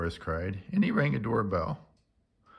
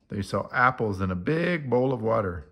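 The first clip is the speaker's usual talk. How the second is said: ordinary delivery, nothing marked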